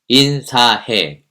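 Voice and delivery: 인사해 said very slowly, each syllable separate; stressed slowly. Shown as a demonstration. Teacher drills the keyword 인사해 slowly. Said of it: "요 세 글자를 기억해".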